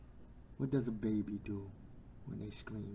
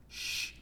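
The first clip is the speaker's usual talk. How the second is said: hushing shush. Acted. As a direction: hush someone down. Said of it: "didn't want him to say nothing".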